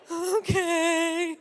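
high, drawn-out whimper; mock tearful little wail; whimpering compliance. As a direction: give in like a crying teen. Teen's tearful reply to screaming mom.

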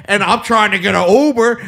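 ordinary talk, nothing marked

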